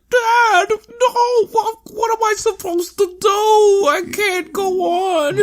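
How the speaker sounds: sobbing falsetto wail; very high, stretched-out mock anguish; mock despairing son. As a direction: bawl it in a hammed-up crybaby voice. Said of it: "And Choji's like".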